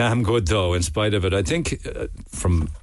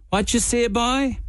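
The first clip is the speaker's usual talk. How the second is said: high Cork fella; slower than usual. Retold or imitated. Cork fella in joke replies.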